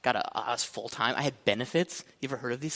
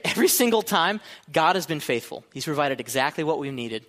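ordinary delivery

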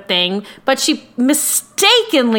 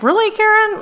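mock disbelief; 9 semitones higher, faster than usual. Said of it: "which is like".

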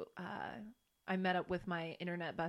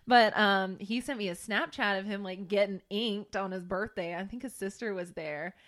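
ordinary delivery

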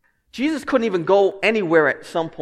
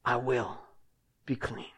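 gentle promise; 6 semitones lower, 10 dB quieter than usual. Pretend. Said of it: "beautiful, simple, powerful words".

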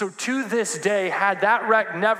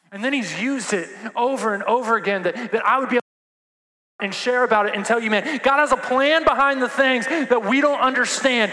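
preaching at full force; 6 semitones higher, 6 dB louder than usual. Acted. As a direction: lean into it like a grieving father. Preaching build, loud and high.

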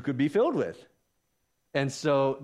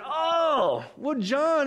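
high, drawn-up exclamation; exaggerated high-voiced reaction; acted realization; 11 semitones higher, much slower than usual. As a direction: let the reply leap up high. Acting Paul's sudden realization.

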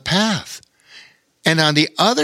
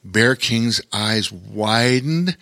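ordinary delivery

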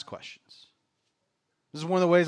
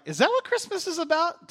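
high-pitched, climbing challenge; mock incredulous question; 12 semitones higher than usual. Parent pausing the TV to quiz kids, mock incredulous.

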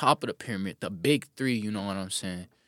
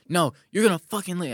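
higher, louder mock fury from Jen; imitated anger; 9 semitones higher, 5 dB louder than usual. Retelling Jen's phone fight, mock furious. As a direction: throw it back like Jen snapping.

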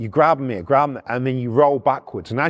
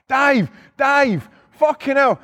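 retold shouting; 11 semitones higher than usual. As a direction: act out yelling for backup. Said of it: "I'm shouting to Dave".

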